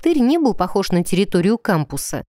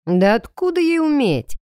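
higher scornful snort; 6 semitones higher than usual. A narrator's reading. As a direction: scoff at her. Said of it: "фыркнул всё тот же усыпитель".

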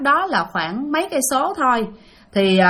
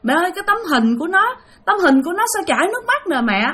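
higher, fast, alarmed; alarmed cry; 6 semitones higher, much faster than usual. Retold or imitated. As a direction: blurt it out in a rush of fright. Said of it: "thì nó la lên".

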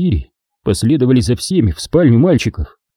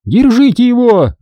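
high, loud command; loud high alarmed order; shouted order; 12 semitones higher, 4 dB louder than usual. A narrator's reading. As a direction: yell to grab it. Obin ordering capture.

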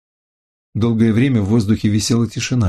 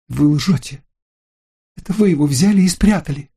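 angry accusation; 7 semitones higher than usual. Acted. Lucille accuses narrator of stealing.

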